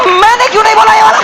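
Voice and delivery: high, loud, fast frustrated cry; comic jealous shout; jealous outburst. Real, not acted. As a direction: yell in envy at missing the line. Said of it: "मेरी जो गांड जली भाई".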